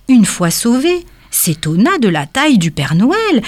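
plain reading voice

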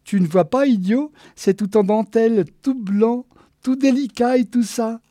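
high, loud, gushing exasperation; excited impatience. Acted. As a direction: chide him while showing off a treasure. Geneviève excitedly scolds slow Walter.

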